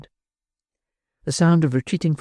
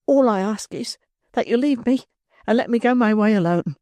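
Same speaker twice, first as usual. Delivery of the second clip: put-on a higher, anguished young woman's voice; a desperate girl torn between fear and hope; troubled young girl; 6 semitones higher than usual. A narrator's reading.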